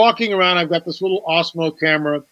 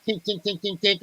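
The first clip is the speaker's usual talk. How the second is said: soft chisel taps; 8 dB quieter than usual. Retold or imitated. Imitating chiseling stone.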